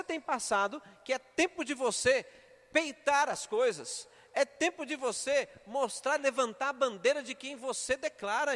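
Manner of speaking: rally the church to act; fiery preaching. Acted. Preaching at full force.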